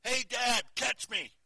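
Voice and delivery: put-on high, loud call of a boy leaping; child's yell. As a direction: shout it like a kid jumping off a rock at his dad. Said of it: "I heard a voice from above me yell".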